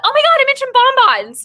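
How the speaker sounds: high excited squeal; suddenly realizing she did it. Real, not acted. Reader realizing she mentioned bonbons, excited squeal.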